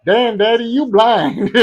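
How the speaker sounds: high, loud mock Puerto Rican voice; Puerto Rican accent. Puerto Rican lady teasing a man, put-on accent. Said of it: "the Puerto Rican voice he was doing".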